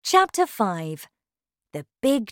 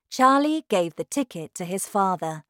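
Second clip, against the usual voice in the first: plain reading voice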